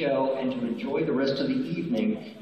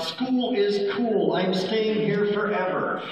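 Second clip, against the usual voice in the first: high, drawn-out rallying chant; slow, high-pitched mock cheer; demonstrated shout; 8 semitones higher, slower than usual. Shown as a demonstration. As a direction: model the shout without full volume. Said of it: "you shout".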